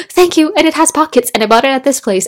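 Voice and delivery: high, loud rush of delight; gushing thanks. Retold or imitated. Woman thanking someone who complimented her dress, excited gushing. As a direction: gush proudly about the dress. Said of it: "like women do".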